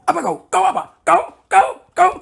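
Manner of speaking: high, loud mock fighting; imitated quarrel. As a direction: fire off short challenges like squabbling spouses. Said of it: "ada orang Kristen berkelahi". (tiruan orang bertengkar, lantang menantang)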